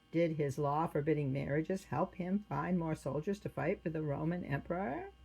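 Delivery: plain reading voice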